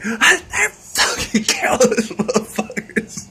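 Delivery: high mock squeal fading to a whisper; mock panicked birds. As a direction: squeal it, then breathe out the insult. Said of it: "they're like".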